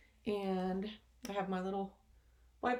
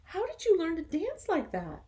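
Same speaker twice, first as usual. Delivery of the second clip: eager question; 10 semitones higher, 6 dB louder than usual. Acted. Animals ask Gerald eagerly.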